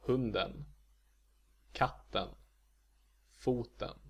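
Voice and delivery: Hunden, katten, foten, each with a single tonal peak; Swedish accent one. Shown as a demonstration. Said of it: "they will keep the same accent".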